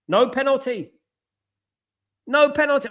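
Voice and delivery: high, loud disbelieving cry; shocked outcry. Real, not acted. Reacting live to the referee waving away the penalty, shocked cry.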